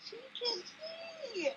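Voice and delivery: high, slow and soft, about to cry; choked up. Retold or imitated. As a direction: squeeze it out through tears, slowly. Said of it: "Let me say this without crying again".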